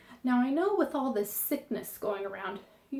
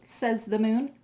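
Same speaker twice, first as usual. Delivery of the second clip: usual speaking voice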